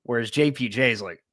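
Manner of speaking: ordinary talk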